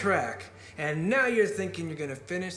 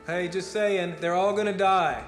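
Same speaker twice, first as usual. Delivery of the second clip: raised, higher, emphatic prophecy; doomsday rant; 4 semitones higher, 3 dB louder than usual. Acted. Speaker tells the crowd everyone will die, ranting loudly. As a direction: hammer each disaster home to the crowd.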